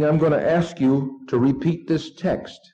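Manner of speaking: ordinary talk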